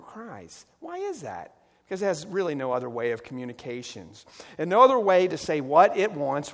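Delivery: usual speaking voice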